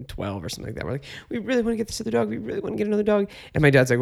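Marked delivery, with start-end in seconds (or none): 1.3-3.3 high, quick begging; pleading little kids. Retold or imitated. Little kids begging dad for a dog, pleading. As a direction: imitate children pestering for a pet. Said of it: "We're little kids".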